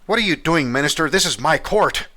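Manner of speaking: king shouting at his minister after the stabbing; outraged king. Acted. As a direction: bellow at the minister.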